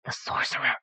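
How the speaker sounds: hushed, urgent stage whisper; loud hiss. A narrator's reading. Onlooker spotting the sorcerer, hissed whisper. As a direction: whisper it sharply to the crowd. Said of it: "as someone hissed loudly".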